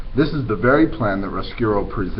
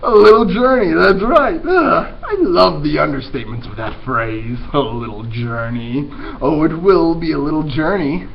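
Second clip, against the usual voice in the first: louder, relishing villain voice; gloating rat; 4 semitones higher, 6 dB louder than usual. Acted. Roscuro relishing the little journey, louder and gloating. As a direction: savor every word like a gloating villain.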